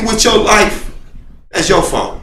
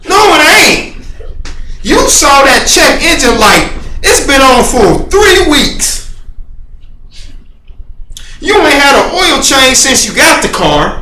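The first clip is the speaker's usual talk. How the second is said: scolding; 6 semitones higher than usual. Retold or imitated. Scolding himself for making excuses.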